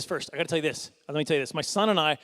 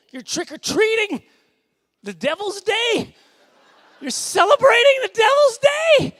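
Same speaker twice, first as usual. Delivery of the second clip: high, loud, drawn-out scandalized cry; mock outrage; 9 dB louder, slower than usual. Scandalized believer at a Halloween sign, mock outrage. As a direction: shriek it in hammed-up horror, slowly.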